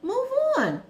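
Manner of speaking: high, loud, fed-up scolding; telling a chronically mad friend to move on, loud and exasperated; exasperated lecture. Retold or imitated. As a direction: shout it at the grudge-holder in disbelief. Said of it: "I'm like".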